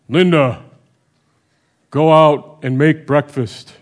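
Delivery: a commanding divine voice calling down by name; God's voice. Pretend. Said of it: "that's how we want to hear God".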